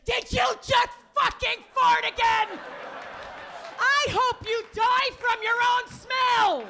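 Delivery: hammed-up, high-pitched furious shout; enraged screaming. Long-time partner fed up with farting, mock-furious scream. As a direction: scream it at a hated partner, drawing out the curse. Acted couple quarrel years later.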